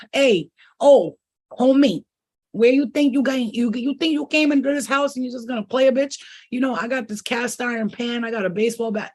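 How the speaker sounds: loud, high mock threat to a rude guy; tough street confrontation. Mocking a rude boyfriend, a loud tough threat. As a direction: square up like a mobster warning him off. Confronting an intruder, acted quote.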